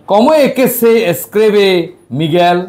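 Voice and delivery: "Responda las preguntas" drawn out syllable by syllable; deliberate, slow classroom reading. Shown as a demonstration. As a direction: read the instruction slowly for students. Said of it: "মিগেল নামটা কিভাবে লিখতে হয়?".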